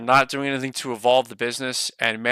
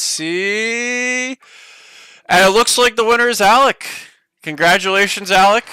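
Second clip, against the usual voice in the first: excited winner reveal; 8 semitones higher, 5 dB louder than usual. Real, not acted. Streamer reveals giveaway winner.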